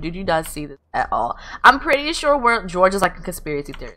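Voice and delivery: usual speaking voice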